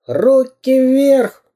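high, drawn-out command; slow, high-pitched threatening cry; villain's shout. A narrator's reading. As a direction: yell it like a cartoon bandit. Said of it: "закричал Дырка".

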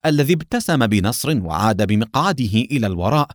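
plain reading voice

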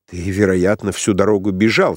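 ordinary delivery

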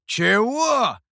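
high, loud outraged exclamation; loud indignant yelp. A narrator's reading. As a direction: burst out in indignant disbelief. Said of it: "возмутился Михоу".

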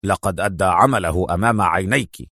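low, stern male character voice; deep, strict rebuttal; sterner. A narrator's reading. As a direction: insist severely in a deep register. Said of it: "قال بصرامة أكثر".

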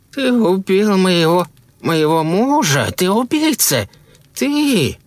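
high, drawn-out wail of accusation; wailing lament. A narrator's reading. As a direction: wail in grief at the killer. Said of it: "Шарлин запричитала".

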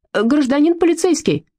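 high squealing call across the park; визгливый крик на весь парк; shrill squeal. A narrator's reading. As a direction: squeal for the police. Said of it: "заверещал он на весь парк".

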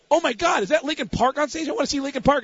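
mock girlish excitement; excited girls. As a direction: imitate girls gushing over a band. Girls excited to see band.

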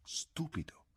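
put-on girl muttering at the boy; muttered. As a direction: grumble it from the doorway. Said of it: "borbottò".

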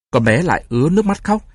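plain reading voice

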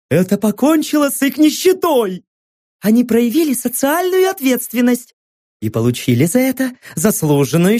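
high, excited propaganda presenter; gushing TV host. Acted. TV host proclaiming success.

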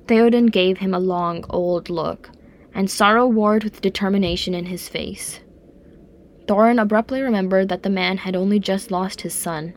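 plain reading voice

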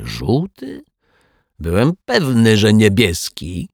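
high, wondering disbelief; feigned amazement. A narrator's reading. Rogue claims he thought it blue, mock-amazed. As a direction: pitch it up as if astonished. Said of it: "zrobił zdumioną minę".

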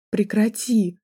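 restrained narrated cry of panic; hysterical cry. A narrator's reading. Student begging the man to stop, mildly hysterical. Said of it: "закричала я истерично".

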